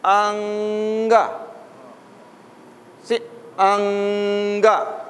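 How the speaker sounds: anga drawn out slowly, nasal ng held long; slow stretched anga. Shown as a demonstration. Teacher stretching anga so students hear the ng. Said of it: "the sound going".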